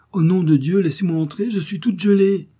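a shivering woman half-whining at the door; near whimpering. A narrator's reading. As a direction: beg to be let in, nearly whimpering. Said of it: "presque en pleurnichant".

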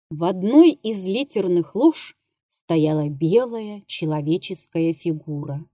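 ordinary delivery

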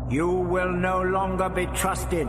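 slow, high monotone verdict; disembodied commanding voice. A narrator's reading. Alien voice passing judgement on the doctor, commanding. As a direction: pronounce it slowly on one high note. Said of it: "At that the tirade halted".

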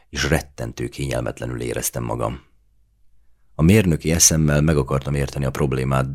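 plain reading voice

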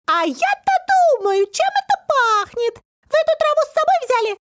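high, piercing exclamation; squeaky shout. A narrator's reading. Little man sniffs out the herb, shrill shouting. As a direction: cry out as a tiny excited creature. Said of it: "закричал он".

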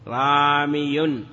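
very slow "رامي" with held vowels; teacher slowly modelling the underlying form; drawn-out citation. Shown as a demonstration. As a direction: stretch out each syllable of "رامي".

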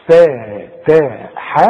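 فتح drawn out very slowly; very slow recitation. Shown as a demonstration. Teacher recites فتح slowly. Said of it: "نتعلم من كلمة".

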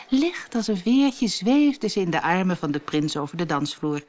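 plain reading voice

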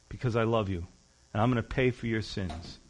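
a hint of a loving stranger; tender. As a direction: gently tell him why. Stranger explains his love.

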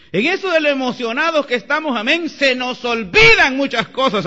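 high, loud preaching with a push on olvidan; fiery preaching. Acted. Pastor proclaiming to the congregation, loud and high. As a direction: proclaim it to the whole church. Preaches, then repeats the line.

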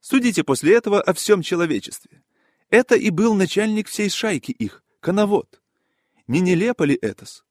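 high, impassioned indignation in character; scandalised gentleman passing judgement; with feeling. A narrator's reading. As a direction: declaim it with feeling, pitched well up. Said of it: "Ярослав Ильич говорил с чувством".

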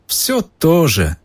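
slow, high, oily shopkeeper. A narrator's reading. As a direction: ooze it out like a greasy merchant. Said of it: "своим сальным голосом".

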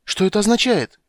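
high and loud, alarmed; agitated. Acted. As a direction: demand it in alarm. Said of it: "в величайшем волнении".

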